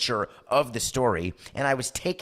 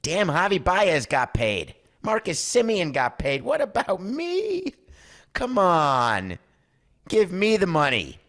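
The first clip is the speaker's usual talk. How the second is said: high, slow, pleading What about me, louder push for money; whiny demand; 8 semitones higher, 4 dB louder, slower than usual. Retold or imitated. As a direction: whine like the one left out. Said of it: "Correa will look at Scotty Boras and say".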